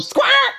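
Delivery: sudden squawk like a bird; loud bird squawk. Acted. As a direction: imitate a harsh bird squawk. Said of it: "as I demonstrated before, they're very loud".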